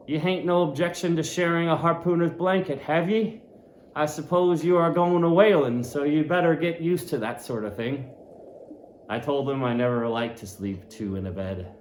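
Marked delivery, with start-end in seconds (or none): 0.1-8.1 landlord's voice. Acted. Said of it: "he added, tapping his forehead".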